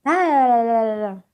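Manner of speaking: loud singsong blocking-out; mock refusal to listen.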